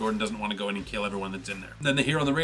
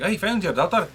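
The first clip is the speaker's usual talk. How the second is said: put-on lilting, higher-pitched Scottish accent; 7 semitones higher, 4 dB louder than usual. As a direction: slip into a Scottish accent for a comic villain. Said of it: "'cause he's Scottish".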